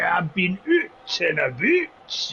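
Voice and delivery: high, slow put-on Scottish accent; hammed-up Scottish greeting, drawn out. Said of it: "proper Scot, that".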